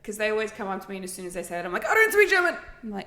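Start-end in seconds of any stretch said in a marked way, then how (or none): 1.9-2.5 louder, high-pitched mock panic; flustered outburst. Shop worker cuts off approaching customers, flustered outburst. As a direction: blurt it out high and loud.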